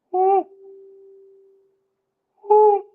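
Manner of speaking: high, loud woo; cheer. Real, not acted. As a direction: yell woo in celebration. Cheering echoes.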